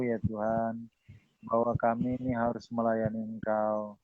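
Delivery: subdued, measured closing prayer; public prayer. Real, not acted. Pastor closing the session before God, prayerful. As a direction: lead them in reverent prayer, phrase by phrase.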